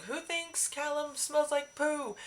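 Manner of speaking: teasing Michael. Retold or imitated. Michael teasing crowd about Callum.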